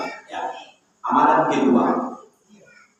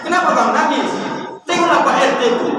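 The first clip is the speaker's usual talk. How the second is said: much higher, fast mock needling; nagging wife; 6 semitones higher, faster than usual. Wife comparing her husband to the neighbourhood head, pitched high. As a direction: needle him with a shrill comparison. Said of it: "nangis dia".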